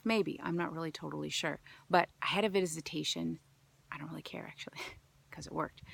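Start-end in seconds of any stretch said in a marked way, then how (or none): 3.9-5.8 breathy whispered aside with a laugh; whispered confiding aside. Real, not acted. Hushed aside.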